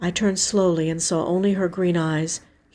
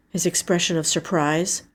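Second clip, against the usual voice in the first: ordinary delivery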